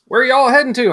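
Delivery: put-on a guard asking where they're headed, in a Southern accent. Security guard asks the Canadians where they're headed, in a Southern accent. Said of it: "He's like".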